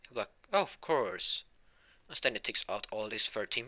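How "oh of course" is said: high, obliging hero voice; All Might. Acted. Hero agrees to sign for a fan, grandly obliging. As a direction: grant it grandly, pitched up. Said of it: "Mister All Might".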